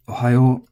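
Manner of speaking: "Ohayo" level from start to end; even-toned Ohayo without an accent; flat pitch accent. Shown as a demonstration. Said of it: "this word is flat".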